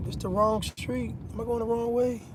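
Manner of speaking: lost driver thinking aloud under his breath; muttering to himself. Real, not acted. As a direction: mutter to yourself while checking the road. Driving aside, checking the route.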